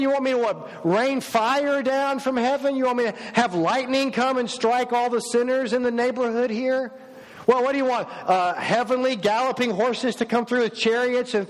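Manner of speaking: exasperated Jesus. Pretend. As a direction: fire off indignant questions, pitched high and insistent. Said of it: "if I'm in Jesus's sandals, I'm gonna go".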